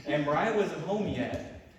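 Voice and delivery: ordinary talk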